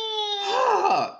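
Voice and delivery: high squealing sigh. Real, not acted. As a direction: let out a high breathy sigh. After excitement.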